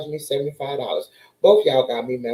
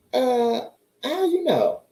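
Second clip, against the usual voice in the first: high pitched disbelieving reply; shocked squeak of disbelief; stunned; 8 semitones higher, slower than usual. Retold or imitated. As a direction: act floored by the question.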